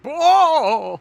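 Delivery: long, loud high holler; crowd outcry. Retold or imitated. People calling out as she walks around, hollering. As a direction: bellow like a gawping crowd, voice way up.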